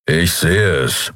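a hint of a low, slow grumble; lightly voiced gruff captain; grumbled. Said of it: "brummte er". (leicht brummend, langsam)

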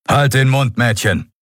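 stern officer silencing someone; gruff command. A narrator's reading. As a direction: shut them up curtly. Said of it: "Telios barsche Worte".

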